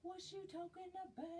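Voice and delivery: high, fast playful repeat of the question; mock puzzled squeak. Rereading a viewer comment, a high, quick, teasing puzzled question.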